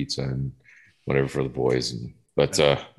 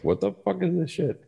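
bewildered mock disbelief; 12 semitones higher, 5 dB quieter than usual. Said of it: "we were just like".

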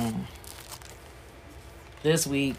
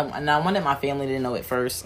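usual speaking voice

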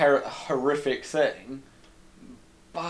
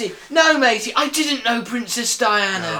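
bad London accent; 8 semitones higher, 4 dB louder than usual. Film cabbie talking Princess Diana, bad London accent. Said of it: "bad accents".

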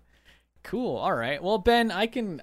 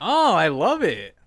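hype-up cheer; 5 semitones higher, 7 dB louder, much slower than usual. Real, not acted. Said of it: "I'm trying to hype you up".

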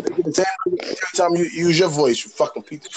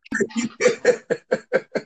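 laugh; 4 dB quieter than usual. Real, not acted. Laughing at the retold argument.

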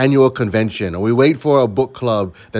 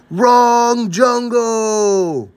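high, very slow hollered call; drawn-out yell; 11 semitones higher, much slower than usual. Acted. Leader in the tallest tree calling down, drawn-out yell. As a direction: yell it from a treetop, stretching each word. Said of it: "and yells".

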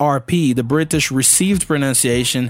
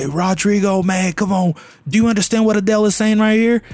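higher, hurried quote of puzzled people; quick, raised-pitch appeal to a friend; pleading quote; 7 semitones higher, faster than usual. Retold or imitated. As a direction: voice their question, pitched up and urgent. Voicing puzzled people's question to him.

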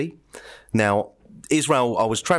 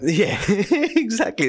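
laughing; 12 semitones higher, much slower than usual. Real, not acted. Laughs at the dig.

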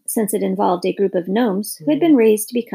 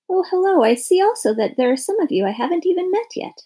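lightly voiced bird, pitched higher, noticing newcomers; a bright, surprised greeting from a big bird; crow, surprised; 8 semitones higher than usual. A narrator's reading. Crow notices new gnomes.

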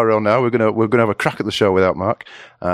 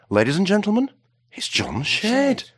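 grand announcer intro; 13 semitones higher than usual. Acted. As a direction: present the star guest to the crowd. Host introduces the guest.

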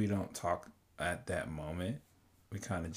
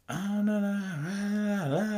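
high, sustained wordless wailing mimicking an argument; high-pitched "ah-la-la" squabble, not truly yelled; mock yelling; 11 semitones higher, slower than usual. Couple going straight to yelling at each other, mock yelling. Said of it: "like, yelling at each other".

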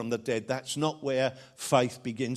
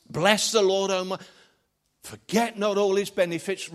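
raised, declaiming psalm line; scripture recitation; 6 semitones higher, 3 dB louder than usual. Acted. Preacher quoting the psalm again to remind the church, raised. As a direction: proclaim it from the pulpit.

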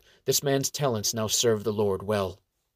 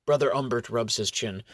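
ordinary talk

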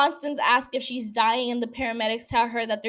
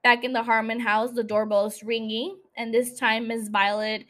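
ordinary delivery

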